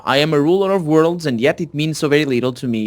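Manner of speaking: ordinary delivery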